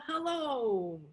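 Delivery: light and glad to see them; cheerful smiling greeting; happy hello. Shown as a demonstration. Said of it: "we're happy to see each other".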